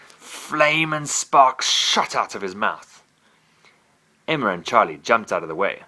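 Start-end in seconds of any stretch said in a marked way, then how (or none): none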